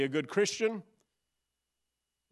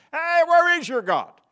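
high, loud scoffing jeer; mocking academic; 12 semitones higher, 10 dB louder than usual. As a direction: ridicule like a smug professor. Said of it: "they mock us".

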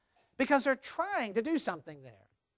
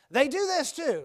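ordinary delivery

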